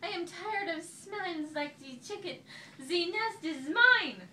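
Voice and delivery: high, loud and slowing at the end; high indignant duck. A narrator's reading. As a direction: huff it out high. Duck claims nest.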